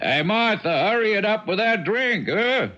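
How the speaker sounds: high call to another room. Acted. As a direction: call for the drink. Calling to wife in another room.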